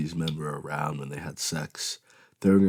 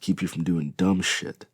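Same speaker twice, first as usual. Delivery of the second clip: plain reading voice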